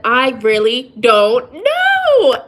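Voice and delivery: really don't know, drawn out slowly; slow emphatic admission. Real, not acted. Admitting to viewers she truly doesn't know, slow and heavy. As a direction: drag it out, truly lost. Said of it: "now that I've spent so many years".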